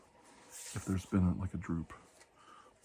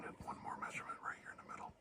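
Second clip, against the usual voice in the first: quick soft unvoiced whisper; 14 dB quieter, much faster than usual. Doctor taking a face measurement, quick whisper. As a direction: rush the words in a hush.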